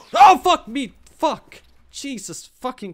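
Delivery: sudden loud, high yell after a gasp; startled scream. Real, not acted. Gamer ambushed while feeling safe, startled scream. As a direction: jump out of your skin and curse.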